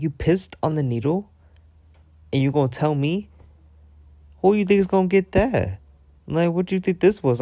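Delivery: high, indignant questioning; incredulous and mad. Retold or imitated. Storyteller retells confronting a man who peed on a needle, incredulous. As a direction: push the questions up in disbelief. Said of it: "that really made me mad".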